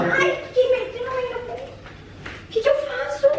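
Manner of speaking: acting terrified of the fake burn in front of her friend; mock fear. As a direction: drag it out like you're truly scared. Faking panic over burn.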